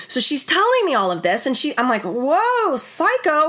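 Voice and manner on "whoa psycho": pitched up in mock alarm; a quick, high jolt of disbelief; startled whoa. Telling a friend her inner reaction, mock startled. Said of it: "Like, in my mind".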